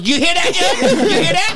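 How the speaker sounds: very high, crowing challenge; hammed-up gloating; mock triumph. Defendant crowing to judge, acted.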